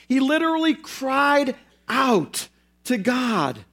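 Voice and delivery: CRIED and OUT drawn out slowly, set apart by pauses; slow, deliberate stress on "cried out to God"; emphatic restatement. Shown as a demonstration. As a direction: spell out "cried ... out ... to God" slowly, word group by word group. Preacher restates the point emphatically.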